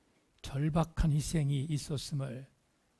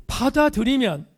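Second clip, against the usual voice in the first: "받아들이면" pitched high and loud; emphatic stress; 9 semitones higher, 7 dB louder than usual. Real, not acted. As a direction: punch out "받아들이면" in a raised preaching voice. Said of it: "다시 강조합니다".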